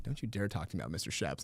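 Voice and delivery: low and soft, fake grave; mock wounded warning. Teacher warning students in staged feud, low mock menace. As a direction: hold the grudge in a low voice. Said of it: "I was like".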